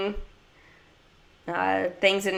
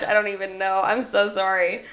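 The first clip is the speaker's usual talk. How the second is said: usual speaking voice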